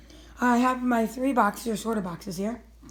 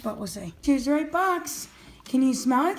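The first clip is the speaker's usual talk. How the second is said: normal talking voice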